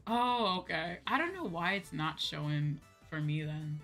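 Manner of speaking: ordinary talk, nothing marked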